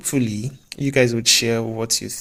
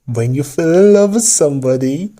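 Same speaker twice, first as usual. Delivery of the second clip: fake British accent drifting Indian; 3 semitones higher, 4 dB louder than usual. Vlogger inventing an English saying in a fake British accent. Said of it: "I think I'm now sounding more Indian than British".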